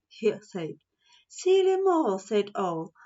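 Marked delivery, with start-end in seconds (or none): 1.3-2.2 high, loud owl voice. Acted. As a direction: owl gently scolding Mole. Owl scolds mole.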